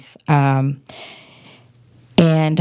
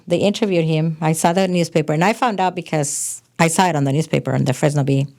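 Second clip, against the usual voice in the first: usual speaking voice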